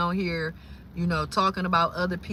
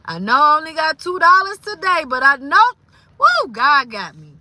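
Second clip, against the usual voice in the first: louder and higher, rejoicing; joyful self-talk; 7 semitones higher, 8 dB louder than usual. Retold or imitated. Quoting her own grateful self-talk, loud and joyful. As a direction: cheer yourself up through hardship.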